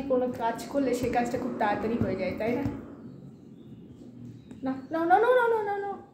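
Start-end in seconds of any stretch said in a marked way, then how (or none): 4.6-6.0 urgent no. Real, not acted. Stopping child, rising alarm.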